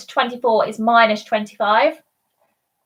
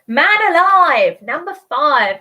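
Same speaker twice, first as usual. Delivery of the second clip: bingo caller; 3 dB louder than usual. Acted. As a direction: sing out the call across the hall. Calling a bingo number.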